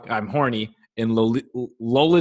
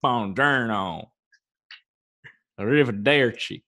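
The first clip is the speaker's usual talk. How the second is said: mock Italian accent; 2 semitones lower than usual. Imitating Brad Pitt's movie Italian, hammed-up Italian accent. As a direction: play a bad Italian accent like Brad Pitt. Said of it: "like Brad Pitt in Inglourious Basterds".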